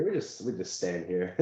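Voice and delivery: low, soft creepy monotone; creepy deadpan. Retold or imitated. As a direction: say it like a lurker in shadows. Acting out the creepy shadow people.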